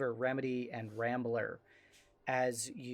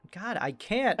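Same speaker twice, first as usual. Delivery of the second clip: usual speaking voice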